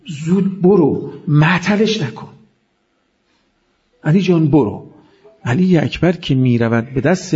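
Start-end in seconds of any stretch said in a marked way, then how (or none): 0.1-4.9 Imam's pleading voice. Retold or imitated. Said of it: "میگه".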